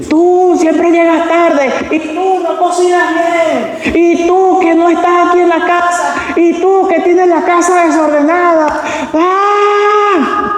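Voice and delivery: high, drawn-out yelled accusations, ending in a scream; mock shouting match. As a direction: act out spouses yelling complaints at each other, pitched very high. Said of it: "Griterías". (pelea de pareja a gritos, fingida)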